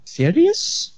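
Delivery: put-on high rising Egyptian-accented "Serious?". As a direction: mimic the Egyptian pizza boss, pitch leaping up. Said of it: "Pretty good Egyptian accent".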